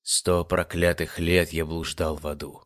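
low, slow and dark announcer voice; dramatic film narrator, dragging it out; ominous voiceover. A narrator's reading. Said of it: "вещал закадровый голос".

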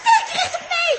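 high, loud alarmed yell; loud frantic screaming; panic shout. Acted. Panic as rats come.

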